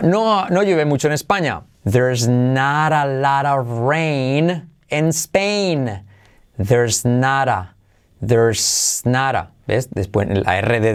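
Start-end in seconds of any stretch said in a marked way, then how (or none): 1.8-9.5 very slow English model sentence, drawn out word by word; very slow demo. Shown as a demonstration. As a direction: read it out slowly for learners. (frase en inglés muy lenta)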